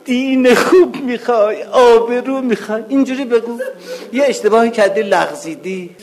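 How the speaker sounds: fervent call to the crowd; rising exhortation. Acted. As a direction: preach it out, climbing in pitch.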